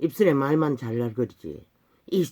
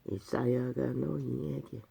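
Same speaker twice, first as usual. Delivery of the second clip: scripture recitation; 2 semitones lower, 5 dB quieter, slower than usual. Acted. Preacher reading Isaiah's rebuke of hypocrites, slow solemn recitation. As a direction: intone the verse slowly, word by word.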